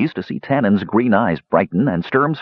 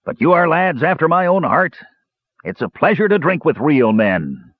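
higher, lightly voiced hearty dwarf; admiring dwarf; 9 semitones higher than usual. A narrator's reading. Dwarf to the lads he is drinking with, admiring. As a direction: praise them as fellow drinkers. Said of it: "said the dwarf admiringly".

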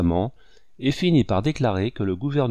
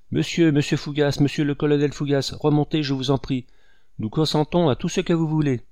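higher pleading call after the colonel; wife calling after the colonel, higher and pleading; 6 semitones higher than usual. Acted. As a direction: call him back up the stairs, begging.

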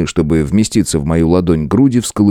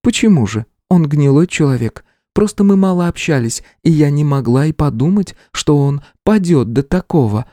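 high, steady female voice; older woman voice; 9 semitones higher than usual. A narrator's reading. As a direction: speak as a seasoned woman warrior. Rangiku voiced.

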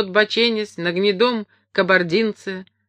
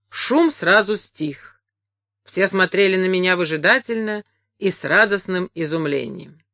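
ordinary delivery, nothing marked

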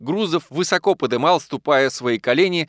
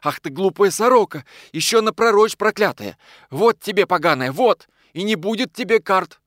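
high, loud scolding; cross, emphatic tirade; angry; 7 semitones higher, 4 dB louder than usual. A narrator's reading. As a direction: berate the wife in a raised voice. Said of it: "говорил он сердито".

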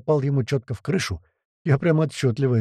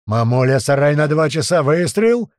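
yelling; 2 semitones higher, 6 dB louder than usual. A narrator's reading. Tuman aims his musketeers at a barn, yelling. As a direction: bellow the target and fire command. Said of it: "продолжал орать Туман".

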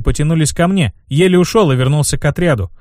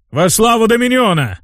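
battle roar; 8 semitones higher than usual. Acted. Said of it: "взревел".

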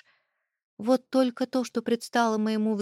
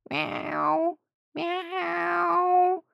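high cat meow; 10 semitones higher than usual. Acted. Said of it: "Кошачий вопль".

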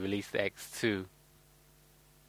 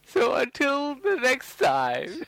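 dramatic sign-off; 10 semitones higher, slower than usual. Acted. Podcast sign-off, drawn out.

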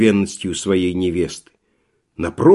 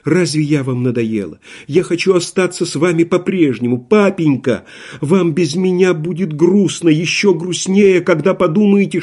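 tearful pleading; 6 semitones higher than usual. A narrator's reading. Daughter begs her father not to force her marriage, tearful. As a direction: plead and keep pushing higher.

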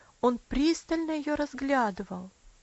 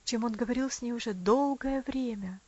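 ordinary delivery, nothing marked

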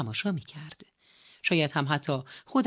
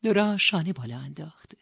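ordinary delivery

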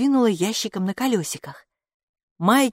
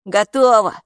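loud, higher one-word answer; calling back; 4 semitones higher, 8 dB louder than usual. A narrator's reading. Капо answers mama behind the door, loud call. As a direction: holler it back through the door.

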